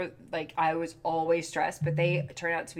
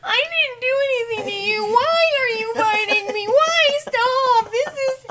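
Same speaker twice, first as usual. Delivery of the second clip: high, loud whiny wail; crying whining voice; 8 dB louder than usual. Retold or imitated. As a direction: whine at the kittens like a wounded child. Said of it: "arguing with the cats in this, like, crying, whining voice".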